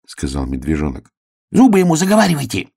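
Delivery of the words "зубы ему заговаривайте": hurried. Acted. Urgent hurried whisper of plan.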